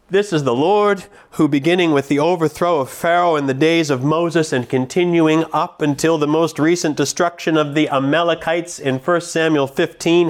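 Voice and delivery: ordinary talk, nothing marked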